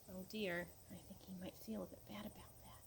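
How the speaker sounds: soft hushed whisper. Real, not acted. Aside to child, hushed.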